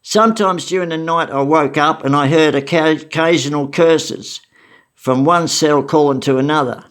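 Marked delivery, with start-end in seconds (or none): none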